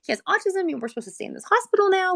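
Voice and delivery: racing inner thoughts of a scared mom; high and fast with rising worry; panicked thought. Retold or imitated. Retold panicked thought in the hospital.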